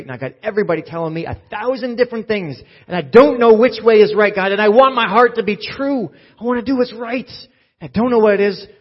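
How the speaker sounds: loud, high pleading prayer; desperate cry to God. Acted. Believer crying out to God for help, loud desperate plea. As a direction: cry out to God like you're breaking.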